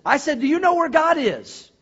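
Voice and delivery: high, loud, insistent demand; acted angry pastor; upset pastor. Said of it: "got really upset".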